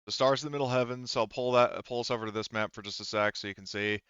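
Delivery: ordinary delivery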